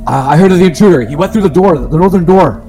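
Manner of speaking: high, sharp thief lackey. Acted. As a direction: call out as an eager henchman. Player voices thief character.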